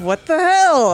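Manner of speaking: loud, high mock outburst; imitated startled reaction. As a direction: act out someone's shocked reaction. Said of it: "the other guy's reaction of".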